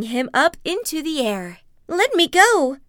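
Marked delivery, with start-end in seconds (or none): 1.9-2.8 higher, louder cry of protest; Sniglet crying out. Acted. Sniglet snatched by the creature, loud high cry. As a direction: yell while being carried off. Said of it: "Sniglet cried".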